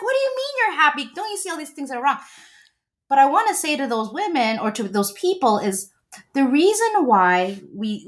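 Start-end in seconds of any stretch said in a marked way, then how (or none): 0.0-2.2 much higher, fast, incredulous mock rant; exasperated wife. Wife mocked as she scolds her happy husband, high and fast. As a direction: rattle it off high and fast, like a wife who can't believe him. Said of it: "she's like".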